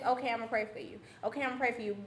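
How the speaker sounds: soft, low repeated promise; hint of grandmother's easy reassurance; grandma's quiet routine reply; mimicking grandmother. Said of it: "she always says".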